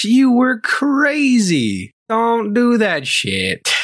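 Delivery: high, drawn-out exclamation; exasperated scolding. Real, not acted. Exasperated rant at the absent woman.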